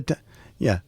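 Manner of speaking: usual speaking voice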